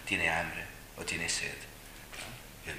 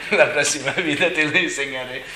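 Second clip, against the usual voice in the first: responde mucho más fuerte, entre risas; riendo; 8 semitones higher, 14 dB louder than usual. Real, not acted. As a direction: suelta la frase riéndose. Respuesta riendo al abuelo. (riendo a carcajadas al contestar al abuelo)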